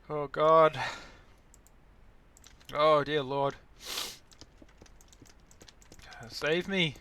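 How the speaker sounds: loud theatrical despair; melodramatic. Acted. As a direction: overact the suffering. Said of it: "I'm just being hyperbolic".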